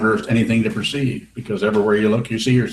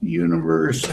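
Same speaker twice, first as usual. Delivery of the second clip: amused echo; 8 semitones higher, slower than usual. Real, not acted.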